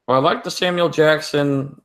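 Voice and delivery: ordinary delivery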